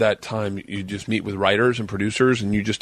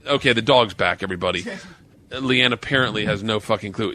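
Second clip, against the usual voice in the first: normal talking voice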